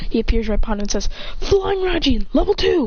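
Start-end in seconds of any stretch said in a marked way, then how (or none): 1.4-2.9 high-pitched anime move announcement; mock battle cry; attack call. Character calls out attack.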